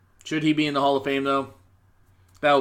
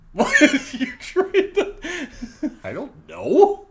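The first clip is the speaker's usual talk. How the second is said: high, slow voice through laughter; laughing; 14 semitones higher, 3 dB louder, slower than usual. Pretend. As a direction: squeak it out while laughing.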